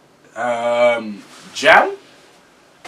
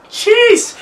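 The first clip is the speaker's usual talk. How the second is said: surprised; 7 dB louder than usual. Real, not acted. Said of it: "dream car question".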